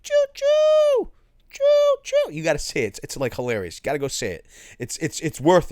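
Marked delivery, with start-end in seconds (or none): 0.0-2.3 very high mock train toot; high piping choo choo, imitating a man's little horn. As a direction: squeak it out like a toy horn. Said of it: "He's like".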